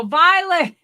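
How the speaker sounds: high, loud name call; mock yelling. Clinic staff calling granny at the table, mock yelling. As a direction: holler the name as if she can't hear. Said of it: "They're still yelling".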